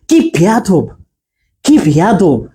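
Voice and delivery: drawn-out, emphatic কি in কি বেয়াদব; long কি. Shown as a demonstration. Teacher demonstrating the intensifier, long emphatic কি. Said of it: "দীর্ঘ উচ্চারণ হবে".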